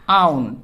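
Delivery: "आउन" with the vowel gliding into ऊ; gliding diphthong. Shown as a demonstration. Teacher showing students a glide vowel, gliding "आउन" into ऊ. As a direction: let "आउन" slide smoothly into an ऊ sound. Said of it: "एक ही sound ऊ पे glide कर रही है".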